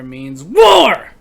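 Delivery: hammed-up battle cry; mock war cry. Mock duel declaration.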